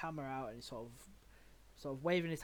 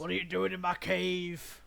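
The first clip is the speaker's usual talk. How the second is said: louder, higher monster challenge; roused cave beast; 5 semitones higher, 12 dB louder than usual. Acted. Game master voices the beast to intruders, booming. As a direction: boom it out at the intruders. GM replays the creature's roused line.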